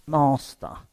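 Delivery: a flat, formal call of Master; addressing God as master. Retold or imitated. Jesus on the cross, not calling God: formal Master. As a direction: say "Master." as a bare title, falling. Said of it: "he doesn't... say".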